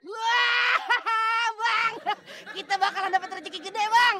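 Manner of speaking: high-pitched excited outburst. Acted. Sidekick telling his boss of coming fortune, excited.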